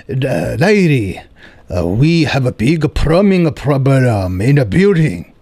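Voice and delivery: put-on slow Korean accent, complaining about plumbing; Korean landlord accent. Landlord tells tenant about plumbing, slow Korean accent. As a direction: drag it out in a Korean accent, reporting a problem. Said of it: "my Korean landlord".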